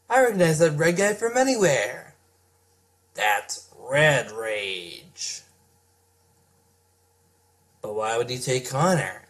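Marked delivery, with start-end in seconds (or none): 3.1-5.4 dramatic reveal. Acted. Recognizing the villain dramatically.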